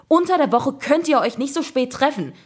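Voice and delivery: scolding. A narrator's reading. Mother telling the boys off about late meetings, scolding. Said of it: "schimpfte sie".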